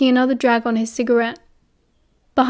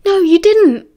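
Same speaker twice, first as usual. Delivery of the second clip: quick, eager denial, higher and louder; too eager; 7 semitones higher, 6 dB louder than usual. A narrator's reading. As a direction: jump in too eagerly to reassure. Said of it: "a little too eagerly".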